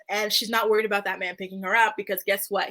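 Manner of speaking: usual speaking voice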